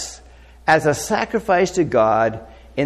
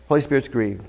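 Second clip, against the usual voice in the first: usual speaking voice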